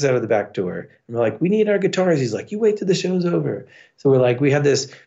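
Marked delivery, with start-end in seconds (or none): 1.4-2.2 pleading protest. Retold or imitated. Kicked-out band pleading. 2.5-3.7 quick, high-pitched imitation of a bouncer; dismissive order. Bouncer refuses kicked-out band their guitars, dismissive.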